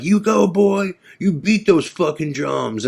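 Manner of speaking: hammed-up drunk cheering at the drummer; drunk yelling. Drunk label owner yelling at the drummer, mock yell. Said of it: "He's just yelling, like".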